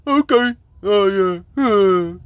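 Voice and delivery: high, loud, dim-witted mock stammer; mocking dispatcher. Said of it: "And they over there like".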